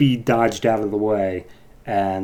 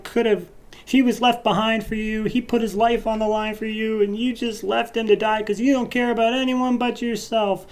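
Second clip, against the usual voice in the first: much higher, slowing put-on voice of an angry woman; mocking Patty; 12 semitones higher than usual. Patty scolding Manuel for abandoning Barry, in a high mock voice. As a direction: squeak out a scornful scolding.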